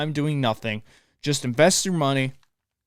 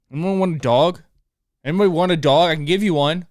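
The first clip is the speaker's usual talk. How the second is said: louder, half-joking shout to everyone; joking call-out; 3 semitones higher, 7 dB louder than usual. Real, not acted. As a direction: call out to the whole room, fed up. Calling out to offer the dog.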